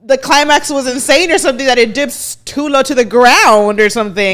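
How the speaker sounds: loud and fast, pitched high; blurting it out, worked up; loud retold rant. To her listener, re-enacting the outburst, loud and high. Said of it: "I'm like".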